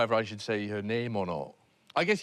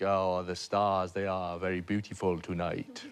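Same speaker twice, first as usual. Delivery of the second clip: put-on a would-be romantic French accent that barely lands; bad French accent; 6 semitones lower, 3 dB quieter than usual. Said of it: "Was that the French accent?".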